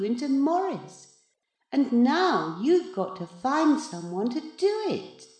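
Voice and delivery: high, measured, condescending lecture; lofty cat in boy's body. A narrator's reading. The new Maurice explains the swap to his good cat, loftily. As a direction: explain it patronisingly, pitched high throughout.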